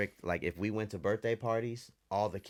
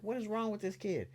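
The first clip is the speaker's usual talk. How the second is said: much higher, mock exasperated mother; exasperated mom; 14 semitones higher than usual. Mom fed up with her crying son, high mock exasperation. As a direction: throw up your hands as a fed-up mom. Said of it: "my mama was mad".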